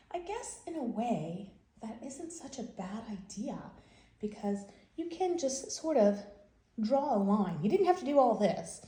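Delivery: ordinary delivery, nothing marked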